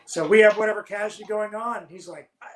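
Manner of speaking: ordinary delivery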